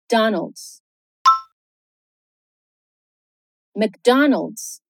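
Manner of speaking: Donald's and McDonald's in American English, no extra vowels; McDonald's ending in a plain ldz cluster; English McDonald's modelled; English pronunciation. Shown as a demonstration. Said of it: "no inglês é".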